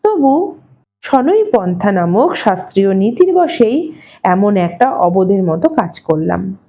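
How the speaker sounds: plain reading voice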